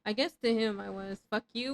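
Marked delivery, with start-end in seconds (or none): none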